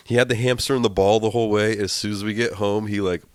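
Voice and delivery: ordinary talk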